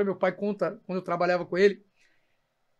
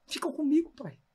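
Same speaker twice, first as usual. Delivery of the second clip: retold plea, high and pleading, softer; pleading with his father; 6 semitones higher, 4 dB quieter than usual. Son begging his dying father to stay, pleading. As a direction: beg him gently to hold on. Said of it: "eu falava".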